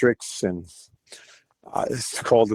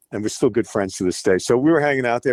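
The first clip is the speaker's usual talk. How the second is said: usual speaking voice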